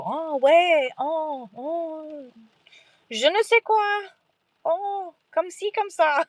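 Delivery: high, drawn-out oohs and ouais; feigned French understanding. Retold or imitated. Pretending to follow French guys chatting at bars, high feigned interest. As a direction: play along as if following every word.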